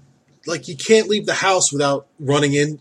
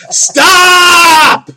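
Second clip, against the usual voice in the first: sudden high, loud shouted stop; 13 semitones higher, 10 dB louder than usual. Retold or imitated. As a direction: cut her off with a yell. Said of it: "Just be like".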